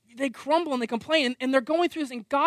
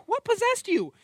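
much higher, incredulous cry; exasperated disbelief; 10 semitones higher than usual. Retold or imitated. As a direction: ask it like a stunned, fed-up parent. Exasperated parent facing screaming kids.

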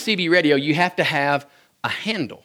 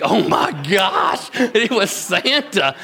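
put-on slow, higher, amazed little boy; awestruck kid; 4 semitones higher, much slower than usual. Boy hearing Santa on the CB, slow awestruck voice. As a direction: gasp it out like a wide-eyed child.

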